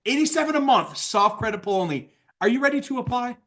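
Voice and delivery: high start on "Eighty-seven", questioning lift on "apply"; inflection in the voice. Shown as a demonstration. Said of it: "Little inflection in my voice".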